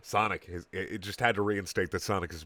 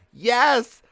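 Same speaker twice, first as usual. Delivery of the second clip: much higher, louder, slow squeaky Tails voice; sad kid voice; 14 semitones higher, 11 dB louder, much slower than usual. A narrator's reading. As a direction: squeak it out like a sad little fox. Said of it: "said Tails to Sonic's mom".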